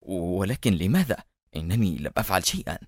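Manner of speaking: soft, low, cowed murmur; hushed, timid protest; fearful whisper. A narrator's reading. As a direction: plead under your breath like a scolded child. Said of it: "وهو يهمس".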